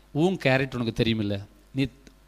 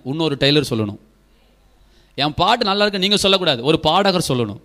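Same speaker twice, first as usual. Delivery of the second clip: normal talking voice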